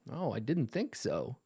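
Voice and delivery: ordinary delivery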